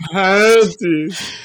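sexy voice. Shown as a demonstration. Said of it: "He'll say it a few times".

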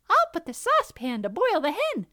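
high, loud cub voice; eager little fox. A narrator's reading. Second fox planning to boil hen, eager. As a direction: pipe up loudly with glee.

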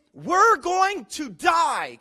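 slow, loud, drawn-out cry of doom; mock panic. Disciples in the sinking boat cry out, in drawn-out mock panic. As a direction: stretch each word like a frightened disciple.

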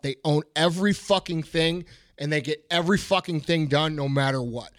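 ordinary talk

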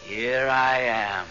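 very slow, otherworldly call through the window; drawn out like a voice from beyond; ghostly greeting. Acted.

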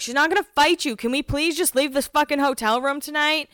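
a mildly exasperated Emily; lightly voiced annoyed friend. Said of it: "Emily's like".

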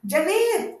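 bright exclaimed phone greeting, pitched high; exclaiming. A narrator's reading. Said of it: "exclamó Fiona".

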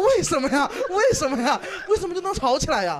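laughing disbelief at the friend's fight; incredulous laughing question. Retold or imitated. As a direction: ask why, cracking up in disbelief.

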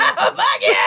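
high, loud, laughing fuck you; laughing mock insult. Real, not acted.

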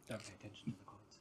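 "Don't pay attention to the coins" whispered fast; whispering. Shown as a demonstration. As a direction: hush it like a secret aside. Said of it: "contrasting statements".

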